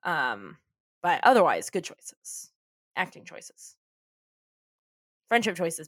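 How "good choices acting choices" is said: hushed aside. Real, not acted. Host clarifying which choices were good, in a hushed aside. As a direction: drop to a whisper to clarify. Aside qualifying the earlier praise.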